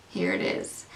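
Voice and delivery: a low, dry, resigned repeat aimed at the box; deadpan aside. Real, not acted. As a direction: mutter it flat under your breath.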